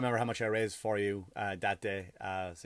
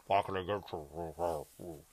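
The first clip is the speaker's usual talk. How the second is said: much lower, slow, muffled Vader voice; Darth Vader voice; 9 semitones lower, 6 dB quieter, slower than usual. Retold or imitated. Comedian imitating the masked server, slow low Vader voice. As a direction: breathe it out like Vader behind a mask. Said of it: "she sounded like".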